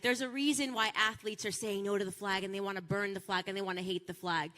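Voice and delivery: ordinary delivery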